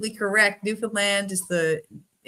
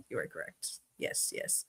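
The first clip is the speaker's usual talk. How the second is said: hushed; 13 semitones lower, 17 dB quieter than usual. Real, not acted. Host fading to a hush.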